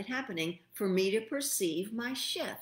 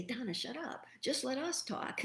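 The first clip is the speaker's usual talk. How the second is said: higher, softer voice of a spirit; hushed, higher shushing from a spirit; scolding spirit; 6 semitones higher, 5 dB quieter than usual. Acted. Spirit telling Donna to be quiet, scolding softly. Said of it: "they say".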